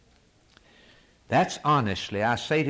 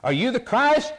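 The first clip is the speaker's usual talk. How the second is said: high, loud demanding question; questioners demanding; 13 semitones higher, 4 dB louder than usual. Acted. Priests questioning John the Baptist, high demanding shout. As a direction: press him for an answer. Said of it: "They came to John the Baptist and said".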